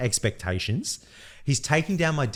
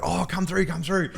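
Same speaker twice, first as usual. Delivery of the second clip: higher-pitched, happy beckoning; lightly acted jolly welcome, pitched up; jolly, happy optometrist; 6 semitones higher than usual. Optometrist waving a patient in, imitated as happy and jolly. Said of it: "he's just so happy".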